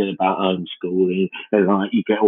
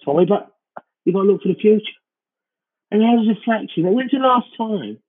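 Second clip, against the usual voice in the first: high, fast frantic ranting; exasperated rant; 12 semitones higher than usual. Retold or imitated. Exasperated dad rant to child.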